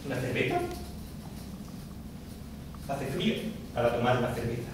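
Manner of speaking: low, quiet, unenthusiastic reply; muted acted refusal; reluctant passer-by. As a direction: turn down the invitation softly and low. Plays the passer-by declining.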